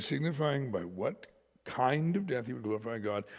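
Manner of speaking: ordinary talk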